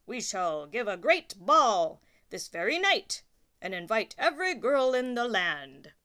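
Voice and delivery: loud, grand royal voice; royal proclamation. Acted. King to the duke, grandly proclaiming a ball. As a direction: announce it to the whole land. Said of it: "said the king".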